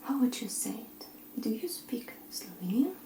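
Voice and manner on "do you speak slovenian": normal talking voice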